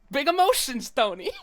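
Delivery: loud, brash New York alligator voice; New York accent. Acted. Game alligator booms at Tony in New York accent. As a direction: play it big and brassy, New York style. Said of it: "The New York voice".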